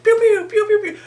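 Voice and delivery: very high playful pew-pew noises; squeaky toy-gun sound effects, laughing at the end; mock gunshot pew. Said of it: "Banks that was like".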